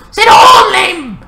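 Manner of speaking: high, screechy demand; mock demand. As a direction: shriek it as an indignant order. Mock demand about name.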